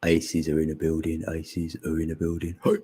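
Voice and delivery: low, chanted stage intro; mock MC intro with a closing hey; show announcer. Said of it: "I'll be your announcer".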